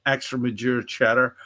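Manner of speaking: usual speaking voice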